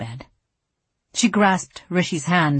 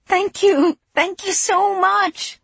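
overjoyed; slower than usual. Acted. Thanking after winning news.

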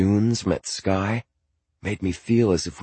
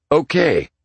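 dad's voice; 9 semitones higher, 5 dB louder than usual. Acted. Dad picks up narrator.

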